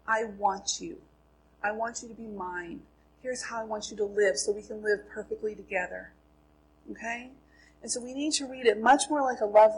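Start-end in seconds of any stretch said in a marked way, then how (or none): 0.1-6.1 soft, intimate and sincere; tender. Acted. God telling the reader of his love, softly and tenderly. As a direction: gently voice a loving letter, very quietly. Voices God's love letter.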